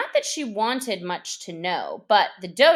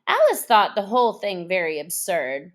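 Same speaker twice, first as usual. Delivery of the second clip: ordinary delivery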